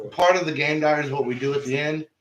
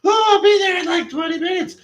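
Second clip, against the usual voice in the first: much higher and louder, a put-on girl's voice; 8 dB louder than usual. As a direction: squeak it out as the date. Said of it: "she's all like".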